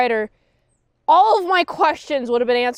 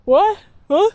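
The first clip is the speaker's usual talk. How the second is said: high, loud startled questions; mock confused Fred; 8 semitones higher, 4 dB louder, slower than usual. Fred, startled by the question, in high mock confusion. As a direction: play dumb and act caught off guard. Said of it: "says Fred".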